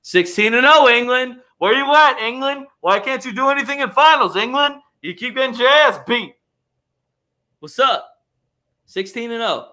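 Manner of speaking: high-pitched, crowing jeers; sing-song gloating at a rival; mocking taunt. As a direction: goad them over their losses. Mock taunting of England fans.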